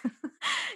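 short high-pitched giggle. Real, not acted. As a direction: squeak out a laugh. Laughing at Arya complaint.

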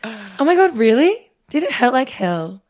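imitating her friend. Host reads co-host's reply about the piercing, mock-shocked imitation. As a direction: act out the friend's shocked question. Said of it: "I'm doing you in like".

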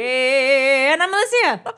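big, drawn-out announcement of your own name; stretched-out, over-enthusiastic intro; grand enthusiastic introduction. Real, not acted. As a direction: introduce yourself grandly, slowly rising. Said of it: "very grand opening statement".

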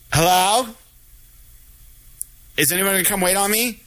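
lightly mocked customer calling out, rising at the end; impatient customer. Customer calling out to be served, mildly impatient imitation. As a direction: imitate someone calling for service.

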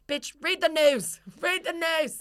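high, drawn-out mock-angry retort; exasperated retort. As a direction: hammer it slowly at the imagined heckler. Acted retort to heckler.

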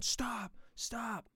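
high-pitched calls to stop; imitating a yelling attendant; mock yell. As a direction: wave someone down, high and urgent. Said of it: "this guy is yelling at me".

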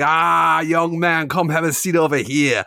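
high-pitched imitation of a British barber; thick British accent. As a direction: hail a customer in broad British. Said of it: "in the thickest British accent".

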